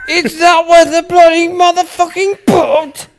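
high, loud, slow mock British rant; hammed-up posh old lady outraged at a putt; angry old Englishwoman. Mocking English golf fans, loud high mock British rant. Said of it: "she's like".

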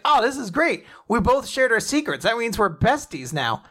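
high, excited best-friend chatter; gleeful. Retold or imitated. Retold glee at becoming best friends.

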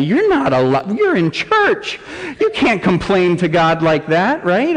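high mock-scandalised churchgoer; hammed-up appalled scolding; nervous objection. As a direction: leap up high in shocked protest on behalf of the congregation. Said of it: "Some of you are nervous for me".

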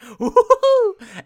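loud, very high hooting "ooh"; hammed-up creepy hoot; suggestive whoop. Neighbor teasing about the key, suggestive whoop.

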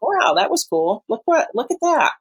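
high-pitched retold amazement; impressed wonder. Host imagines looking back on progress, impressed wonder. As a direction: marvel at it, pitch well up. Acts out admiring one's past.

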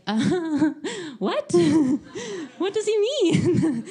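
high, laughing mock bewilderment; laughing confusion. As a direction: giggle through the confusion. Confused reaction, retold.

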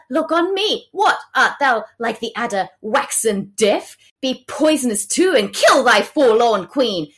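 Queen Margaret. Acted. Queen demands attention from mourning Henry, theatrical indignation. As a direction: declaim it as a theatrical, wounded complaint. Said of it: "then Queen Margaret's like".

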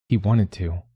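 ordinary delivery

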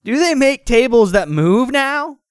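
high, slow cartoon sidekick question; high-pitched wondering character voice; Tails. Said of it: "asked Tails".

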